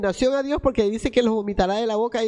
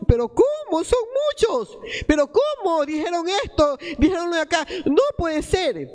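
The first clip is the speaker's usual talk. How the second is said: incredulous protest; 6 semitones higher than usual. Retold or imitated. Preacher mimicking a doubting believer, high incredulous protest. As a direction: act out the dismayed believer who can't accept it. Believers panicking when God tests them.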